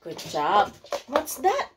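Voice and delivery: usual speaking voice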